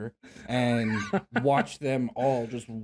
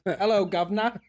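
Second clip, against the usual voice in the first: hammed-up Cockney hello; mock British accent; 7 semitones higher than usual. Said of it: "That's what he said".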